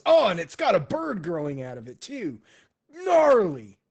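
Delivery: loud, high-pitched wonder; boisterous, impressed outburst; amazed dude. Acted. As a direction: marvel at it, stretching out the last word. Kung Po marvels at the bird.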